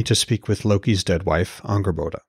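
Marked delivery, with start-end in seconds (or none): none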